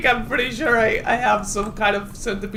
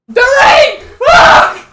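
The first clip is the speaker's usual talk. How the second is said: scream; 16 dB louder, much slower than usual. Real, not acted. Scared by rain in game.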